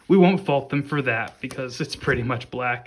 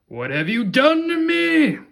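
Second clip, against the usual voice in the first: high, slow, anguished villain cry; Doctor Octopus voice; 13 semitones higher, 5 dB louder, slower than usual. Retold or imitated. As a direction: wail it high and drawn out like a tormented mad scientist. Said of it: "That's my Doctor Octopus impression".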